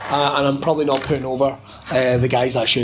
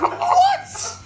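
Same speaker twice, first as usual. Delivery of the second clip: shocked what. Retold or imitated. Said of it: "he's like".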